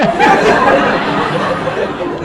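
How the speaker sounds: wheezy breathless chuckle; a long delighted old-timer laugh; old man laugh. Acted. Laughs at own joke.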